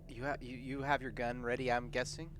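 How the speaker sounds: ordinary talk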